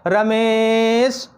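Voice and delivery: रमेश with a long stretched मे, as if calling someone far away; prolonged calling vowel. Shown as a demonstration. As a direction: draw out the second syllable of रमेश. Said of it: "दूर होता है तो उसको हम लोग बुलाते हैं".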